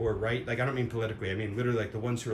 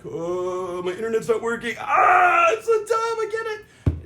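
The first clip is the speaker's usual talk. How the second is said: much higher, louder mock whining cry; whiny minion; 10 semitones higher, 7 dB louder, slower than usual. Mocking dependent minions, high loud whining complaint. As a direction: whine like a helpless brat who lost internet. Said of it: "all you minions are just like".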